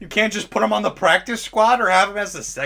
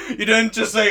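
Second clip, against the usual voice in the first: ordinary talk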